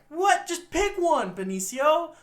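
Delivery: high, loud mock exasperation; exasperated outburst. Scolding the actor for wavering accent, loud exasperation. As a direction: burst out in exasperated disbelief.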